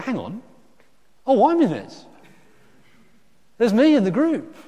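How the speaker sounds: high, loud shocked discovery; mock horror at being in the picture; sudden realisation. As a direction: gasp at spotting yourself in the photo. Acted realisation in old photos.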